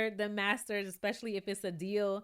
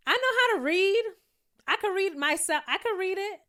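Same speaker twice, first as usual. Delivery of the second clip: louder, higher, offended come-back; indignant; 9 semitones higher, 6 dB louder than usual. Pretend. Imagined Tasha snapping at Nick, indignant and loud. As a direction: snap back like you've been insulted.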